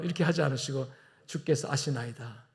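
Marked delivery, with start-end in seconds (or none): 1.2-2.4 humble reply. Acted. Peter's humble reply voiced.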